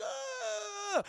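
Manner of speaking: high shrieking cry of pain; high mock scream. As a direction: shriek high like you've been bitten. Husband bitten, screaming.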